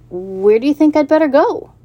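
put-on a young pig unsure of the world; nervous young pig. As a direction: pig asking the goose for directions. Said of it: "Wilbur asks goose".

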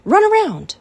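high, loud command. A narrator's reading. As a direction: order him like a coach. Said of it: "commanded Charlotte".

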